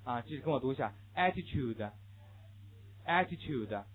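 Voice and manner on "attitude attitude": slow "attitude" ending in -chude; read-after-me sound change. Shown as a demonstration. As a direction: model "attitude" with tu as chu. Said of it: "注意这两种音变".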